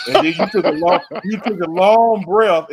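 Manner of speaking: laughing through the words. Real, not acted. Friend teasing the co-host about his long pause, laughing. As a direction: crack up while describing it. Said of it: "laughing while teasing".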